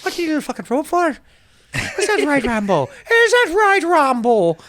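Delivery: high, loud, fast mock Irish rant; movie Irish rant. Quoting a film scene to friends, as a high, loud, fast Irish rant. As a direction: needle him like a mouthy Irish thug.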